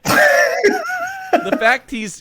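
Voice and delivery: high, loud, drawn-out yell; excited shout. Real, not acted. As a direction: shout it out in delight. Gleeful shout.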